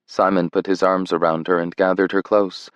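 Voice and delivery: ordinary delivery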